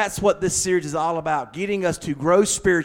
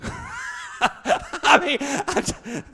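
laughing; much slower than usual. Real, not acted. Laugh burst.

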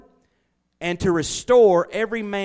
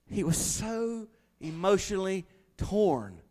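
ordinary talk

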